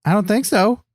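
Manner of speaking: usual speaking voice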